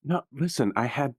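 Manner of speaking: ordinary delivery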